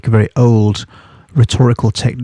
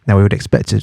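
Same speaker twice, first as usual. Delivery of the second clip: ordinary delivery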